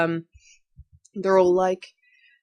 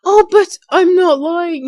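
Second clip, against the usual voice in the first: high, slow, whiny protest; a spoiled villain whining she isn't lying; 10 semitones higher, 8 dB louder, slower than usual. Shown as a demonstration. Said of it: "she'll be all like".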